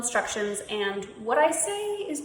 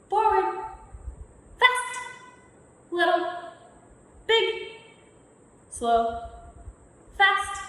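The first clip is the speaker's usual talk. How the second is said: calling out commands; 5 semitones higher than usual. Acted. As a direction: call each move out to the room like a game leader. Calling out opposite game commands.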